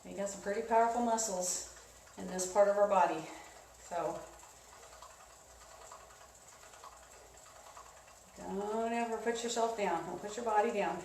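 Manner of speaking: gentle, reassuring coach; soft cool-down voice. Real, not acted. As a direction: ease them down softly. Cool down encouragement.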